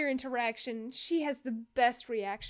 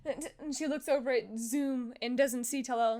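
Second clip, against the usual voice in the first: usual speaking voice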